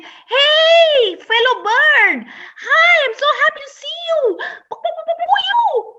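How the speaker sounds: high and bright greeting from the sky; high bird voice calling hello; high cheerful crane voice. Acted.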